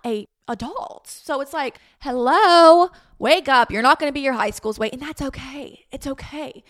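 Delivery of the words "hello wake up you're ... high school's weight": loud, high mock wake-up call. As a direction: snap them out of it, loudly.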